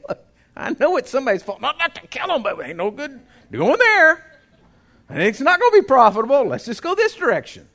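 loud, high, urgent encouragement; encouraging shout. Retold or imitated. Boss urging his workers onward, loud and high. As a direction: fire them up, loud and high. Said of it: "trying to encourage them".

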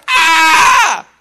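loud, drawn-out cry with a laugh; half-laughing cry of pain; mock pain cry. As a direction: shout out like a painful birth. Acting out birth pain.

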